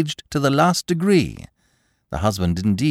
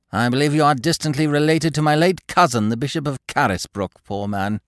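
prim Mrs Appledore; 6 semitones higher than usual. A narrator's reading. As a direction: refuse politely but coldly.

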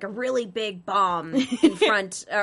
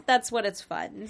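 ordinary delivery

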